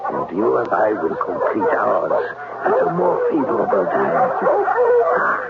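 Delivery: put-on menacing German accent. German-accented Klemper hints at what he wants once they're alone. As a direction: let the threat creep into the German accent.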